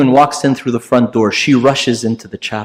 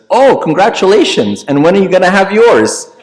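high, bright and louder, teasing; mock surprised congratulations; 9 semitones higher, 5 dB louder than usual. Blunt diner teasing the heavy priest, high and loud.

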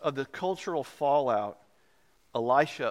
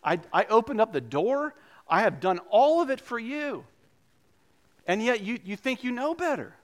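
pleading Jesus; 7 semitones higher than usual. Retold or imitated. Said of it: "Jesus is like".

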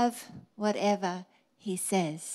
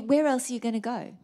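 ordinary delivery

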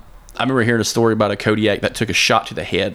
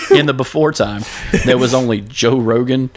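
epic fantasy narrator; 3 semitones higher than usual. Pretend. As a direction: tell it like an ancient myth. Mock legend opening.

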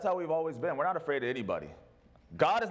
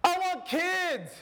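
whiny demanding people; 10 semitones higher, slower than usual. Pretend. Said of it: "people are like".

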